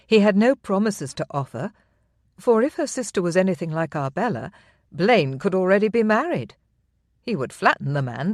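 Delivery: usual speaking voice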